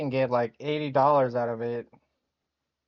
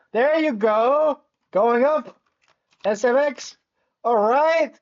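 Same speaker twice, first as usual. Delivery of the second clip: high, loud cheering as it rises; excited cheering; 9 semitones higher, 6 dB louder, slower than usual. Real, not acted. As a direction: cheer the ticker on.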